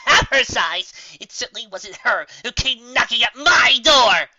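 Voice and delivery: from wry to loud shouting; building rant. Acted. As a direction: wind up into a furious tirade. Trixie ranting, building to outrage.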